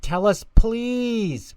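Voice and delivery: put-on high, slow pleading toddler; begging baby voice. A narrator's reading. Twins begging Angelica to share, high slow pleading. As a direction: beg like tiny twins. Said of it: "begged Phil and Lil".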